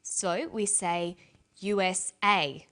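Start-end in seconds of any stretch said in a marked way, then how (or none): 1.6-2.7 U S A with the final A stronger; stress on last letter. Shown as a demonstration. Teacher showing learners primary stress, final A of U S A stressed. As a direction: say U S A, punching the closing A. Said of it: "last letter is pronounced more strongly".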